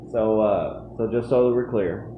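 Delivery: ordinary talk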